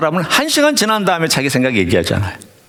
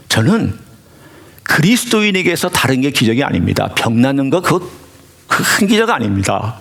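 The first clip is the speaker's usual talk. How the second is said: ordinary talk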